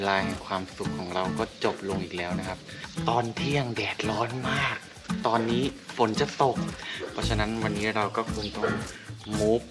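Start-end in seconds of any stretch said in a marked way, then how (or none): none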